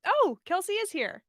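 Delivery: plain reading voice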